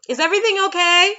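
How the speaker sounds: high, loud and fast call to the next room. Retold or imitated. A mother calls out loudly and quickly to her noisy kids. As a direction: shout it through the house, worried.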